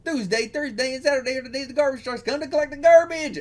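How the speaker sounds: high, fast, loud cartoon voice; explaining beggar character. Beggar explaining garbage truck days, high rapid voice. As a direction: rattle off the schedule high and loud.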